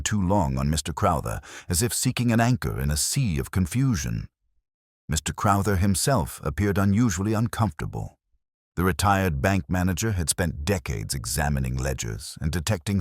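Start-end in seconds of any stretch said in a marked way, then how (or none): none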